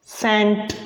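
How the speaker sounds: cent said short; English example word. Shown as a demonstration. Teacher modelling सेंट for students, short e. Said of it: "जैसे".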